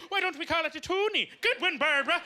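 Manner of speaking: high, pleased suburban mom voice; soccer mom. Retold or imitated. A Cape Breton soccer mom proudly suggesting a name, high and pleased. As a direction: pitch the idea like a proud mom at a meeting. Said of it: "soccer mom from Cape Breton was like".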